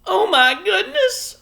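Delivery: very high, loud slow wail; a little mother overwhelmed, half crying; moaning. A narrator's reading. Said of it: "moaned Homily".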